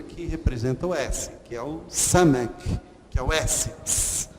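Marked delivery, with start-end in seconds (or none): 3.8-4.3 ts as a sharp voiceless hiss after a t; ts sound demo. Shown as a demonstration. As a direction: hiss ts through the teeth. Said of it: "Sade vai sempre ser esse T S aqui". (ts soprado, sem voz)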